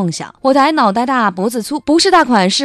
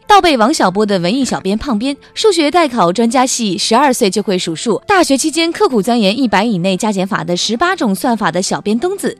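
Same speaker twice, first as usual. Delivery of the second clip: infomercial; 4 dB louder than usual. Acted. Parody ad, listing experts.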